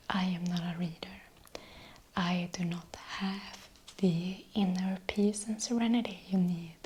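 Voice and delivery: ordinary talk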